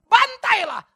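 high, loud shouted demand for slaughter; hammed-up vengeful cry; mock vengeful plea. Pretend Jesus begging the Father to slaughter them, shouting. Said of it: "Dia nggak bilang".

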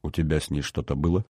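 soft, low probing question; in an undertone. A narrator's reading. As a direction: ask it under your breath. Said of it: "сказала вполголоса".

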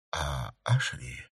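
soft, slow, tearful question; anxious whimper. A narrator's reading. Count looks for the girl, tearful soft question. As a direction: ask afraid of the answer.

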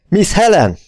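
magas, visszafogott felolvasói kiáltás; kiálts rá felháborodva, magasra emelt hangon; üvöltés. A narrator's reading. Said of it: "üvöltötte Rainer". (üvöltve)

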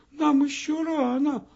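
put-on high, small and bright child-like voice; a wee girl telling the hour it's too early; Minutka's tiny voice. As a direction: answer like a tiny minute looking up. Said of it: "ответила Минутка".

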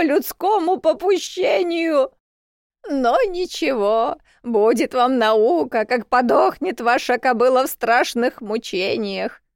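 высокий дребезжащий голос, медленно; старуха проклинает, нараспев; grumpy old woman. A narrator's reading. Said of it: "вещала она". (сварливая бабка пророчит беду)

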